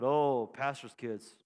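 knowing. Retold or imitated. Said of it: "I said".

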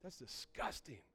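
put-on a young convert sneering at men crying; disgusted aside. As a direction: scoff at it quietly. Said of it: "I'm like".

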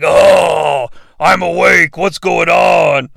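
booming, dazed creature voice; a monster roused from sleep, bellowing confusion; groggy monster waking. Acted. To no one, the Incubus bellows awake in confusion.

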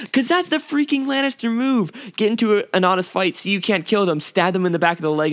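high, loud, exasperated outburst; worked-up fan outrage; indignant rant. Real, not acted. Indignant rant about Lannisters.

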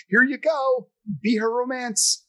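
imitating someone cheerfully pushing him into a scene; mock director. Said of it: "if it wasn't sort of like".

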